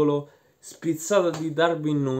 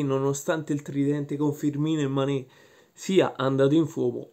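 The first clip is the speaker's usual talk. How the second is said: ordinary delivery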